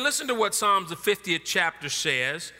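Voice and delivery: ordinary talk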